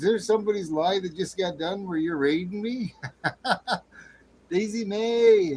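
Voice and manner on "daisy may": excited greeting. Real, not acted. Greeting a viewer loudly.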